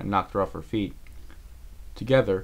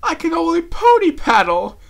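very high, loud, slow wail; panicked cry; 12 dB louder than usual. A narrator's reading. Rarity to her rescuer in the water, wailing in panic. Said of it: "Rarity cried".

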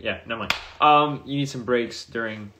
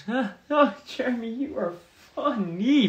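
mock teasing; 11 semitones higher than usual. Real, not acted. Teasing a chatter called Jeremy.

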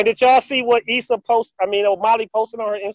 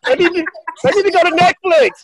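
laughing; 8 semitones higher, 3 dB louder than usual. Real, not acted.

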